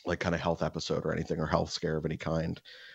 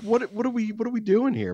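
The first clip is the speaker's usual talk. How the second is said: much higher, incredulous mock whine; exasperated disbelief; 14 semitones higher than usual. Left alone in the exam room, much higher incredulous whine. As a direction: throw up your hands in disbelief at the waiting. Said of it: "And I'm like".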